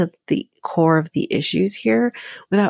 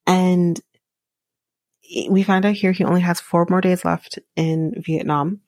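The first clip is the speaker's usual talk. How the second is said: usual speaking voice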